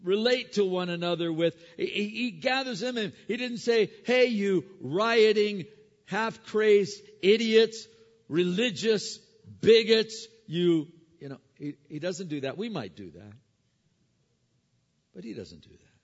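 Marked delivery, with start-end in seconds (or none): 4.0-10.9 insulting shout. Acted. Mock insults Paul didn't say.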